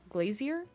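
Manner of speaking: glazier with z and ee-er, softly questioning; trying out glazier. Retold or imitated. Guessing at the British pronunciation, tentative glazier. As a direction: softly test glazier, rising at the end. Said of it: "is it glazer".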